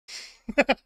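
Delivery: loud delighted laugh; high gleeful laugh. Real, not acted. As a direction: laugh out in triumph. Elated after puts print.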